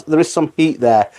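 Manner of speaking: ordinary talk, nothing marked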